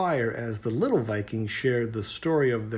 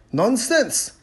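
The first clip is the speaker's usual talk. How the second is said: louder, blustering chief; dismissive chieftain; 11 semitones higher, 6 dB louder than usual. A narrator's reading. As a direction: wave it away like the proud chief. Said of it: "said the chieftain".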